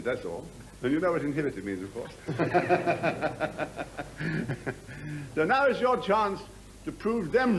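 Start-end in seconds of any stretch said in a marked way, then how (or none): 2.2-5.3 long run of chuckles; hearty laugh at own joke. Acted. Officer amused by his jibe at the crowd, long laugh. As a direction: laugh along at your own quip.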